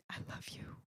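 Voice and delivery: breathy near-whisper, barely there; retold whisper. Retelling the producer choking out his reaction, whispered. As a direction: whisper it like a man too moved to speak. Moved man quietly answers after trailer.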